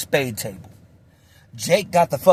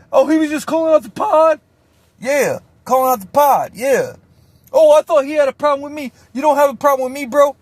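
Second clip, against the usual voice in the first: high, loud nervous backpedal; squeaky mock relief; high relieved mock; 10 semitones higher, 4 dB louder than usual. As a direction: act relieved he's off the hook. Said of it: "Shane backing down".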